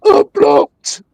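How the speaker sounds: very high far whiny wail, mocking; mock crying wail. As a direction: squeal it like a bawling baby. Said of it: "Fucking wuss".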